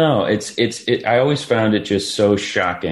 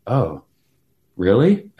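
retold surprise; 5 semitones higher, 4 dB louder, slower than usual. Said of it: "And I was like".

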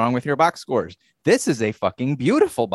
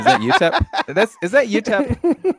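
high, slow 'Is that utep?' through laughter, louder; laughing question; 11 semitones higher, 5 dB louder, slower than usual. Real, not acted. Cohost laughing while guessing which team is satanic, laughing. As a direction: giggle out the joke guess.

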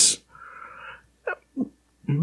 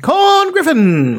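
put-on high, slow old-time fight announcer; high sports announcer impression; slower than usual. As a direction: call it like a thirties ringside announcer. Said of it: "they did speak that way".

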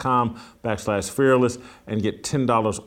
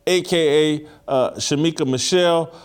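ordinary talk, nothing marked

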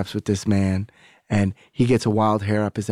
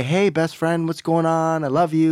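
high, gushing best-friend greeting; overdone buddy-buddy cheer, pitched up; mock friendly; 7 semitones higher than usual. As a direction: sing out an exaggeratedly chummy hello. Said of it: "but not friendly".

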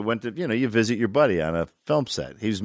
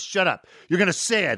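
higher-pitched mock-irritated order; pissed-off director; 7 semitones higher than usual. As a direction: imitate a director cutting off an argument. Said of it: "Then he got pissed".

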